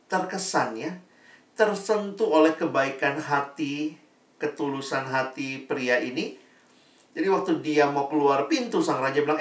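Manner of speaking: ordinary talk, nothing marked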